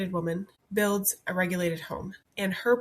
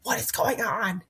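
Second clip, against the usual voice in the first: exasperated parent; 2 semitones higher than usual. Retold or imitated. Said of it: "you're like".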